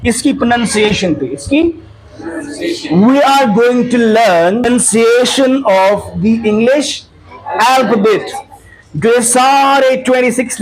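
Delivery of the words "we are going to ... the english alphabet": slow announcement. Shown as a demonstration. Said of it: "teacher stating lesson title slowly".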